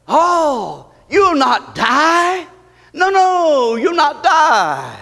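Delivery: high, drawn-out denial; devil's mock reassurance. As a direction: play the enemy slowly dismissing the danger. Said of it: "It's the enemy's way to create doubt".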